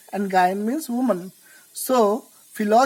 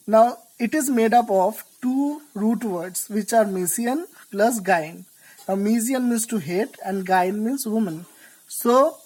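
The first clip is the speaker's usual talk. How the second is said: normal talking voice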